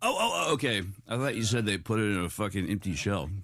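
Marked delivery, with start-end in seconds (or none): 0.0-0.5 high sudden realization; surprised oh. Real, not acted. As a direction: gasp it out as it clicks. Realizing misunderstanding.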